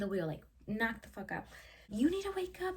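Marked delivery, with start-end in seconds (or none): 1.9-2.8 higher alarmed plea; frantic higher call to the passed-out driver; urgent. Retold or imitated. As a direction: panic as you try to wake him. Trying to wake passed-out driver.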